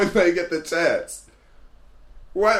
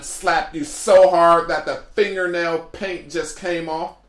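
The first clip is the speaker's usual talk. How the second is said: usual speaking voice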